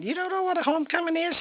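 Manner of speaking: high, soft, incredulous question; can't believe she's never heard of it; retold disbelief. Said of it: "I'm going".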